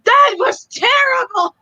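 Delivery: high, loud, bad Irish accent; mock Irish accent. Imitating a friend's Irish voice, loud mock Irish accent. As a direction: blurt it in a put-on Irish accent. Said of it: "if I started talking with the Irish accent".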